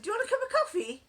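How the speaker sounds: high fast call up the stairs; shouted upstairs. Retold or imitated. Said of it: "So I shouted up and I said".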